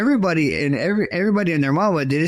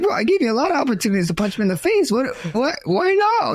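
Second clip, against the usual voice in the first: exasperated disbelief; 8 semitones higher than usual. Retold or imitated. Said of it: "I was like".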